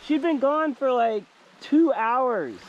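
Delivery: high, loud, drawn-out cry of delight; thrilled, slow, high-pitched exclaiming; excited reunion. Real, not acted. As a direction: call out overjoyed at a lost dog's return. Lost dog turns up.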